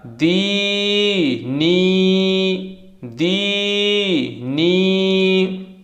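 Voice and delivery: दी नी with both ई vowels drawn out very long. Shown as a demonstration. Teacher showing how to lengthen the vowel: दी नी very drawn out. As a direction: stretch the ई in दी नी slowly. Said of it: "दोनों को खींच के पढ़ेंगे".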